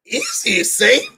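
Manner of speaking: nervous. Retold or imitated. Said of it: "And I was like".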